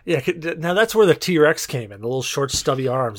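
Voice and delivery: ordinary talk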